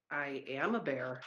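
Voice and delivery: the bear softly repeats that he is a bear; quiet insistence. Acted. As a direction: say it gently, almost to yourself.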